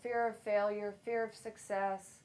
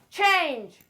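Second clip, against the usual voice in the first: high shouted single word; yelled word; 7 semitones higher, 10 dB louder than usual. Shown as a demonstration. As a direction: yell it in their faces. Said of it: "I'm gonna try and scare you".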